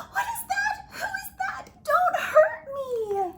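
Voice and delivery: high, loud panicked shriek. Acted. Yeti startled by a shadow, shrieking. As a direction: cry out in terror. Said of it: "jumped with a shriek".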